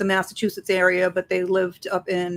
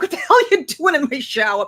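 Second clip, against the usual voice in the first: high, sharp, startled demand; startled outburst; 7 semitones higher, 4 dB louder than usual. Retold or imitated. Speaker startled by a dead friend appearing in the shower, high startled outburst. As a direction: blurt it out, caught off guard.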